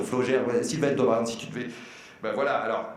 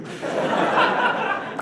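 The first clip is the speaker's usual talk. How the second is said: high burst of laughter. Real, not acted. Laughs after his gaffe.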